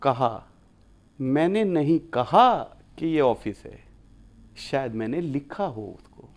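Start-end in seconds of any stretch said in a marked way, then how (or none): 1.2-3.8 कहा lifted and pressed, the rest kept even; teacher stressing कहा in the example. Shown as a demonstration. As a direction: hit कहा, as if it was written, not said. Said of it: "अगले अगर मैं शब्द पे जोर दूँ, कहा".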